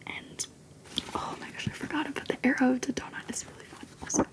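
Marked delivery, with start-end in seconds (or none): none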